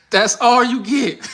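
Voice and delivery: high, slow, laughing tease; stretched-out laughing come-on; laughing flirt demo. Shown as a demonstration. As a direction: draw it out through the giggle. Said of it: "Let me show you again".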